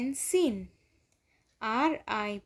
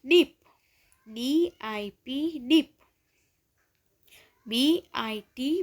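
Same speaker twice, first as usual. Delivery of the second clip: spelling drill; 3 dB louder than usual. Shown as a demonstration. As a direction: chant it like a primary teacher spelling words aloud. Teacher leads spelling drill.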